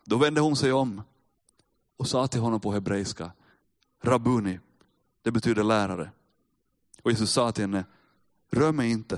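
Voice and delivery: measured Bible reading to the congregation; calm preacher reading scripture. Acted. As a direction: read the passage steadily, letting it land. Reads John 20 aloud.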